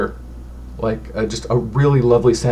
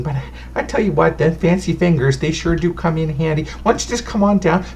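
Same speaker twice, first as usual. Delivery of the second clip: put-on higher, doting old fortune teller fretting over dust; a chatty granny clucking over dirty guests; bustling, motherly old woman voice; fussy old lady; 6 semitones higher than usual. Old lady keeps talking.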